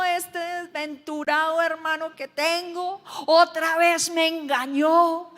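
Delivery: high, loud, hammed-up plea to his father; anguished pleading Esau. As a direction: wail the lost blessing like a betrayed son.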